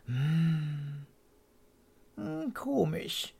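high, slow squeaky hum; squeaking puzzled. A narrator's reading. Mr Swallow sniffs the sweet snow, high puzzled squeak. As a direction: peep it out, puzzled. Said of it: "fiebte Herr Schwalbe".

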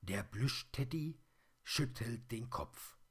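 usual speaking voice

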